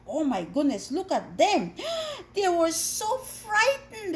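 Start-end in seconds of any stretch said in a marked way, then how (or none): 1.8-2.3 sharp high gasp of alarm; storytelling gasp; dramatic gasp. Acted. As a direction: gasp for the little listeners. Storm, children's story gasp.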